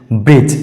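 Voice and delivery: ब्रिज said as "brij", d silent, rising; correct pronunciation. Shown as a demonstration. Teacher to students on silent d, clear ब्रिज. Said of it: "इसका सही उच्चारण हो जाएगा ब्रिज".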